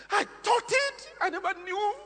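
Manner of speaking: high-pitched mock wail; retold crying. Preacher retells a woman's tearful confession, high imitated wailing. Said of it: "she started crying".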